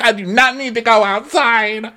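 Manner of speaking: high-pitched, put-on protest; mock defiance. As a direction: imitate an indignant refusal up high. Acted refusal to leave.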